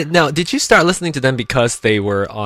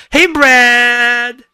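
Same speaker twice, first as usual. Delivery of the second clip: calling out; 11 semitones higher, 6 dB louder than usual. Real, not acted. Greeting caller on the show.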